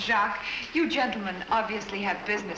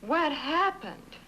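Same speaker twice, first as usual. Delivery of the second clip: alarmed surprise; 7 semitones higher, slower than usual. Acted. Shocked at smoke.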